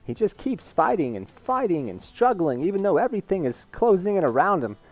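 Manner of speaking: high, swooping celebrity impression of a father; impersonated dad urging his son on, pitch leaping; Christopher Walken. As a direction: tell a fable in halting, lilting bursts. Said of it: "he's talking to his son. He's like".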